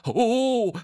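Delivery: high, wobbling "whoa" of effort; loud balancing yelp; straining wobbly cry. A narrator's reading. Bo trying to keep balance, strained cry. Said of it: "angestrengt das Gleichgewicht zu halten".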